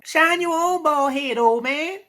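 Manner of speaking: mock high-pitched yell at the heckler; mock comeback. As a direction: fire it back in a squeaky mocking yell. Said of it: "he got a bald head too".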